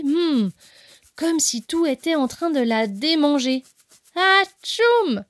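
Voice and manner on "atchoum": high, loud sneeze sound; big sneeze. Acted. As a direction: burst out with a sneeze. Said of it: "elle éternua".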